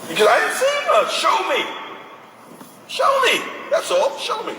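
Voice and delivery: mimicking a fed-up mother asking to see proof; demanding parent. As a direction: repeat show me like an upset mom pleading. Voicing the disgusted mother.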